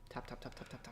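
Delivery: soft, voiceless run of taps; rapid tapping imitation. Co-host skipping through friend's story, quiet tapping. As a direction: patter it out quietly like a finger tapping.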